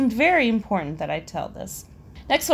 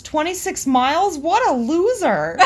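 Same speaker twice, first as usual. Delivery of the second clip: higher-pitched mock scoff; blurted insult; 6 semitones higher, 3 dB louder than usual. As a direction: blurt it out as a botched joke. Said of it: "translated that joke into the words".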